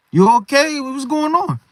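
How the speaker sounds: high-pitched worried check-in; concerned questioning. Acted. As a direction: press a troubled friend for an answer. Acts out worried friend checking in.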